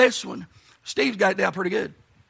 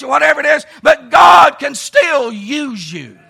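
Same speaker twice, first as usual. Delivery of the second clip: preaching; 4 semitones higher, 8 dB louder than usual. Real, not acted. Preacher urging no-name people that God can use them, preaching. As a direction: preach it out with punch and conviction.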